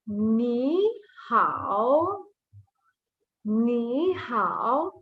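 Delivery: slow ni hao, with hao dipping and rising; ni hao in careful, drawn-out Mandarin tones; read with the tones. Shown as a demonstration. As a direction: draw out ni, then let hao dip and lift. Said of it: "we read it with the tone".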